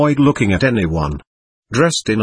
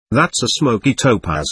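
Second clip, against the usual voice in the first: ordinary delivery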